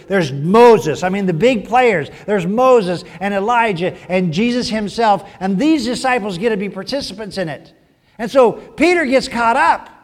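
ordinary delivery

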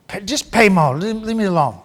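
a grumbling squirrel who wants peace; weary squirrel. Acted. Squirrel voiced brushing Rabbit off.

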